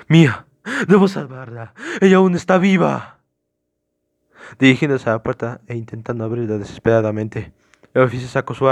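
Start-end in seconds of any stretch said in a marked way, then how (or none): 0.1-3.2 higher, louder desperate cry; desperate urgency. Acted. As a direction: build to a frantic insistence. Suspect desperate to save his fiancée.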